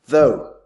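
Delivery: "though" set apart and given full weight; a weighty, isolated "though", falling at the end; emphasized "though". Shown as a demonstration. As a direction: land firmly on the single word "though". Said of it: "I emphasized the word".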